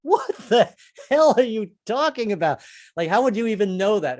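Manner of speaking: high, loud disbelief; incredulous audience. Retold or imitated. Audience doubting the comic, high and loud. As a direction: demand an explanation. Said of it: "I know you guys are going".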